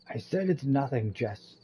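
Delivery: testy, firmer brush-off; irritated insistence. Acted. Kyle pressed by Jess to confess, irritably insistent. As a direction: insist firmly to shut down the questioning.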